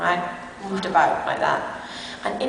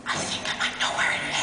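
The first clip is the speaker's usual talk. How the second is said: sly whisper; 7 dB quieter, faster than usual. Retold or imitated. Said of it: "And he went".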